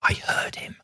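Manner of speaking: hushed whisper, all breath. A narrator's reading. Aunt insisting to nephew after the cough, whispered. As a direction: whisper it urgently to the one beside you. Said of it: "she whispered".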